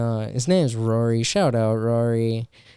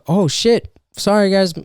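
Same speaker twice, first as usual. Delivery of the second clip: higher, louder fake panic; mock hurried exit; 6 semitones higher, 6 dB louder than usual. Comic imagining ditching the stage, mock hurried. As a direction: bail out of the set, hammed-up. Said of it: "I could have been like".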